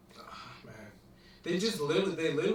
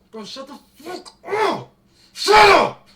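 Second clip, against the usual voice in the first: high, loud outburst building to a yell; high-pitched, fed-up scream; exasperated shout; 13 semitones higher, 15 dB louder, slower than usual. Real, not acted. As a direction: blow up and shout him down. Exploding at the actor he mocks.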